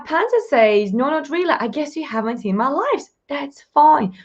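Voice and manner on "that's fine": ordinary delivery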